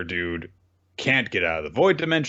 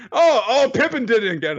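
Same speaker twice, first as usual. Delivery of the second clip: much higher, louder whine; whiny tattletale; 13 semitones higher, 5 dB louder than usual. Retold or imitated. Sen blaming Pippin, acted.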